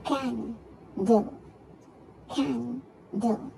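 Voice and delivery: "Candle" split by a gap, can, dle; syllable by syllable. Shown as a demonstration. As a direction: break "Candle" into two separate beats. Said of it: "two syllable".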